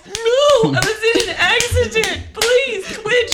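comic panicked pleading; acted pleading. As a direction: beg them to stop, hammed up. Victim begging child to stop.

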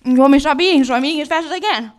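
loud defensive snap, mouth full; defensive shout. Acted. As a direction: yell back while chewing fast. Defensive snap while eating.

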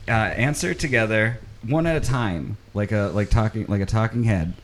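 ordinary talk, nothing marked

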